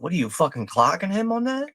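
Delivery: ordinary talk, nothing marked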